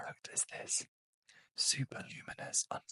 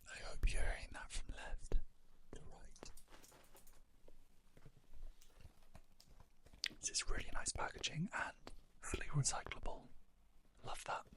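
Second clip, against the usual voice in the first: ASMR whisper. Real, not acted. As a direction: whisper close to the mic, winding down for sleep. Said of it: "where I'm not talking like this".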